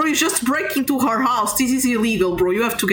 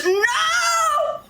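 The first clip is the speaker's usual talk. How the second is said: alarmed protest; 11 semitones higher, slower than usual. Real, not acted. Gamer pleading against burning the witch, high alarmed protest. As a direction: pitch leaps into a high drawn-out no.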